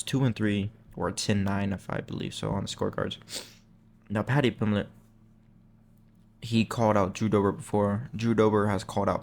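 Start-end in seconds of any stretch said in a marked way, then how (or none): none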